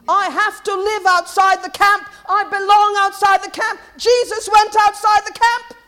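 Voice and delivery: loud, high, pounding proclamation; vehement. Real, not acted. Preacher urging life outside the camp, vehement. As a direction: hammer each phrase out at full preaching force. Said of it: "That was vehement".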